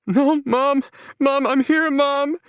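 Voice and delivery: high, loud anguished cry; shouting. A narrator's reading. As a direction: scream for her mother. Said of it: "she shouts into the room".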